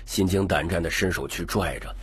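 ordinary delivery, nothing marked

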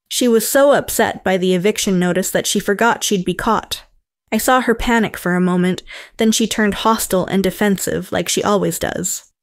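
plain reading voice

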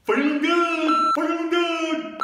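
slow, high, loud Southern drawl "feeling good"; southern accent. Retold or imitated. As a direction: ham up a Southern twang, stretching each word. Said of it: "with a southern accent".

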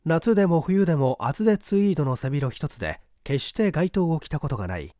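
ordinary delivery

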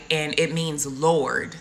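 ordinary talk, nothing marked

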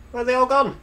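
high, loud startled question; shocked that everyone vanished; surprised. Real, not acted. People suddenly vanished.

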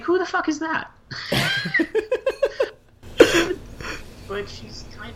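normal talking voice

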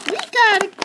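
high, loud mimicking voice; mocking imitation. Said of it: "don't do that mimicking voice again".

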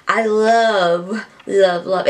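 ordinary talk, nothing marked

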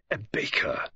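affronted scorn, held in; scornful. A narrator's reading. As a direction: say it with wounded disdain. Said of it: "said Poirot with scorn".

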